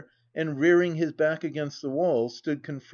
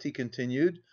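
ordinary delivery

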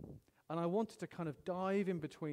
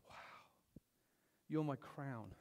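ordinary talk, nothing marked

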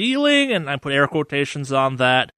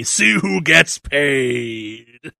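slow drawn-out announcer; much slower than usual. Acted. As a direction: stretch it like a hype ad. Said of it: "keep that fucking voice up".

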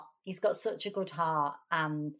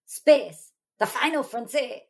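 higher, punched-out take on a sci-fi intro; Cooley Ranks; 4 semitones higher, 4 dB louder than usual. Retold or imitated. Friend imitating the stage intro, raised put-on voice. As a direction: boom the first word, then lift the rest.

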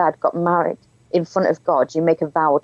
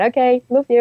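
embarrassed reply; 7 semitones higher than usual. Retold or imitated. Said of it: "really embarrassed".

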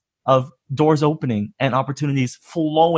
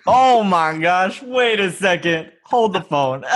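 ordinary talk, nothing marked

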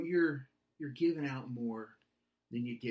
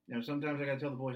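ordinary delivery

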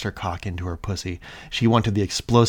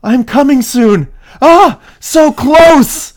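straining high cries; 19 dB louder, much slower than usual. Acted. Character near climax, crying out.

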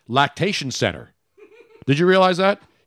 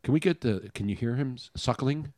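hushed nature documentary voice, much softer; wildlife watching whisper; 3 semitones lower, 10 dB quieter than usual. Pretend. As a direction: drop to a hush so you don't startle the animal. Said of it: "Shh, shh, shh. Let's watch and listen live".

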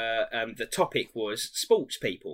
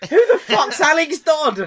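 high, loud outraged yell through laughter; laughing disbelieving shout; 11 dB louder than usual. Retold or imitated. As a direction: bellow it in mock outrage. Retold group shouting in disbelief.

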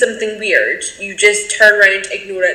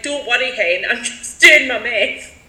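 put-on thick Glaswegian accent. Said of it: "in a thick Glaswegian accent".

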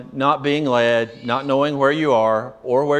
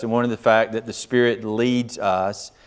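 normal talking voice

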